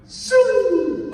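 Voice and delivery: a sharp rising whistle of awe; impressed whistle. Retold or imitated. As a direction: whistle like the ball is flying far. Admiring own deep throw.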